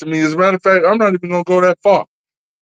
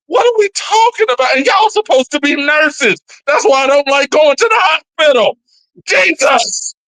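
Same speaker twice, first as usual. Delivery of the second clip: much higher, fast incredulous rant; exasperated; 8 semitones higher than usual. Real, not acted. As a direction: throw up your hands in disbelief. Exasperated outburst.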